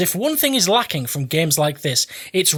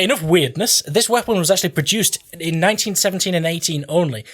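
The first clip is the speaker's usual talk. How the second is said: usual speaking voice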